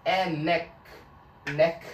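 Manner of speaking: "neck" punched and cut short; break after neck. Shown as a demonstration. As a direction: stop sharply on "neck". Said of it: "에서 끊고 약간 끊는 느낌".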